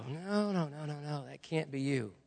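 soft, muttered string of refusals; hushed refusal. Retold or imitated. Preacher refusing God at five in the morning, hushed. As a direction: back away under your breath. Acts own reluctant reply to God.

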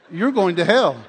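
warning the hitchhiker, loud and firm. Retold or imitated. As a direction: hit going to hell hard. Final warning to robber.

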